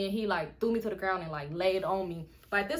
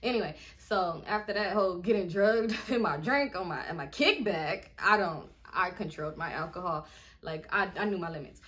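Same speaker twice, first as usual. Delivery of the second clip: ordinary talk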